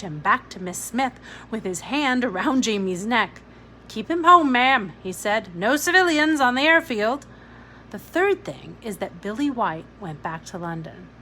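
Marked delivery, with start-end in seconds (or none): 3.9-7.3 much louder, high barking officer; stern officer. A narrator's reading. Officer to Miss Smith about Jamie, loud and stern. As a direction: order it like an officer.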